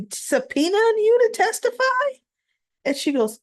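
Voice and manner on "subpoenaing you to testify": shocked question. Retold or imitated. Molly stunned that Christina is subpoenaed, shocked. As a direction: pitch jumps way up in disbelief.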